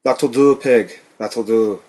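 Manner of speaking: mock Scottish accent; slow, hammed-up Scottish accent. A narrator's reading. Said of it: "In a mock Scottish accent".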